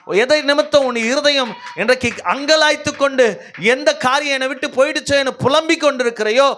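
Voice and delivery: high, driving sermon delivery; impassioned preaching. Real, not acted. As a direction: build one long urgent appeal to the congregation. (உணர்ச்சி பொங்கும் உரத்த பிரசங்கம்)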